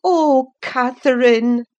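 high, loud cry of reproach; sorrowful cry. A narrator's reading. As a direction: cry out in grieved disappointment. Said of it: "I cried sorrowfully".

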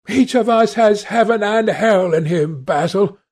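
high, impassioned outcry; despairing cry. A narrator's reading. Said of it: "cried Dorian with a wild gesture of despair".

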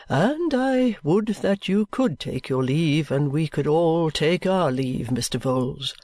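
slow, low and a touch bitter; weary resentment held in check; bitter guardian. A narrator's reading. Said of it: "returned my guardian bitterly".

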